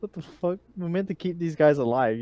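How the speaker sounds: normal talking voice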